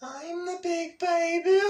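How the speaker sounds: very high, loud, slow cartoon wail; big baby voice. Doing an old voice from Flapjack, big baby wail. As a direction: squeal it out as an oversized baby. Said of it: "that's a voice I used to do".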